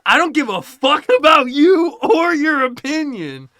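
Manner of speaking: Michael blowing up at Trevor, yelling; furious shout. Retold or imitated. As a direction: explode at him with total contempt. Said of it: "Michael just goes".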